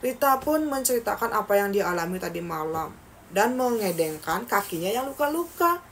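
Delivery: usual speaking voice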